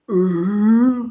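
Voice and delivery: a drawn-out lioness moan; lioness call. Shown as a demonstration. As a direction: howl like a lioness mourning her cub. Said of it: "Started doing this".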